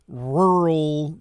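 "rural" with a rolled r; "rural" with the r trilled. Real, not acted. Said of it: "Can you say rural again?".

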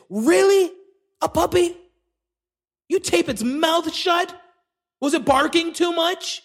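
outraged disbelief, jabbing questions; incredulous. Real, not acted. Incredulous at taped mouth.

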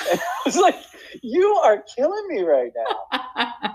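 laughing at the actress's bluntness. Real, not acted. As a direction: laugh as you say it, delighted.

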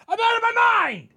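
high, loud, unhinged yell; frantic shout. Acted. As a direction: yell it like you've lost your mind. Mock-frantic outburst about losing it.

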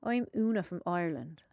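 low, soft put-on Irish accent; Irish accent impression. Shown as a demonstration. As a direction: show off your Irish accent, quietly. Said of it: "Listen, wait".

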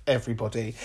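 ordinary talk, nothing marked

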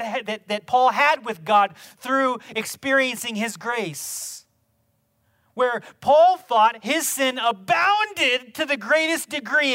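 loud, rising fervent preaching; full-force preaching. Acted. Pastor preaching Paul's words to the church, fervent and loud. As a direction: preach it with mounting fire.

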